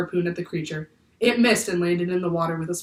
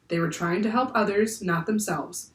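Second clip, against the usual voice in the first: usual speaking voice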